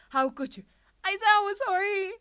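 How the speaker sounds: high, loud hysterical shriek; screeching. A narrator's reading. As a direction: shriek it while tackling her. Izuku screeching, tackling Ashido.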